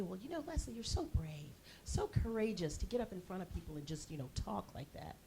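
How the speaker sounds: softly voiced sympathetic admirer; admiring well-wisher. Well-wisher praising Leslie's courage in comedy, soft mock sympathy. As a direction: lightly imitate hushed, earnest praise. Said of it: "somebody will say".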